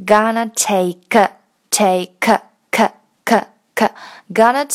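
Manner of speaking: slow take with the k repeated k，k，k; slow pronunciation demo. Shown as a demonstration. Teacher drills the k sound slowly for students. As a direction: stress the final k of take. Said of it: "在take的连读里面".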